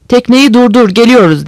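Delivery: understated captain's shout; mild megaphone shout. A narrator's reading. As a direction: call an order over to another boat, restrained. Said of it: "Botun kaptanı megafonla".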